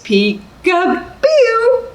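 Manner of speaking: very high, slow drawn-out peek-a-boo; peekaboo. Acted. Librarian playing peekaboo for babies, very high and drawn out. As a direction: pop out with a squeaky surprise.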